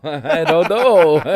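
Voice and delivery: loud, high, drawn-out mock reply; exaggerated helpless answer, very slow; mock clueless. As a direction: stretch it out loudly through laughter. Mocks clueless reply, laughing.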